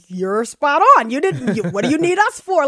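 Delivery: excited exclamation. Pretend. Podcast host voicing her reply.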